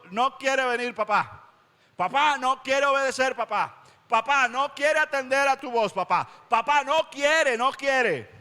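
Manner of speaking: high, repeated plaintive calls of papá; child tattling to papá. Retold or imitated. Preacher as a child telling papá someone won't obey, plaintive. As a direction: whine to your father that he won't obey. Pastor complaining to God the Father.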